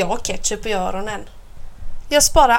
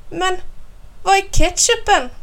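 dismayed question; 9 semitones higher than usual. A narrator's reading. Child finds no ketchup on table.

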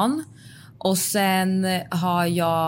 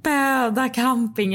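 slow, higher-pitched awed repeat; starstruck amazement; 5 semitones higher, slower than usual. Real, not acted. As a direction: savour the name, drawing it out. Starstruck reaction to the campsite. (överväldigad förtjusning)

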